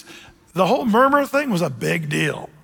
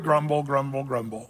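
softer, lower grumble fading out; grumbling sound; 5 semitones lower, 6 dB quieter than usual. Shown as a demonstration. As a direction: grumble the word until it dies away. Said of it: "another automatopoeia word".

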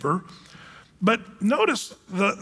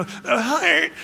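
higher mocking gushy voice, stretching heart; mock TikTok youth; 5 semitones higher than usual. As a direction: parody a teen swooning over the heart. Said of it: "you young people on TikTok".

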